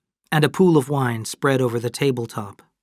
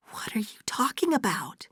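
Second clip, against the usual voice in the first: high, whispered alarm; frightened bewilderment; 10 semitones higher, 7 dB quieter than usual. Acted. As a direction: ask in a soft, high, breath-only voice. Fay frightened by Kate's change.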